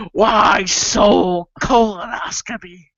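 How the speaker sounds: slow, drawn-out villain taunt; Joker parody. Acted. Mad father to his child, slow Joker taunt. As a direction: stretch it like a hammed-up movie villain. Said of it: "Joker colonoscopy".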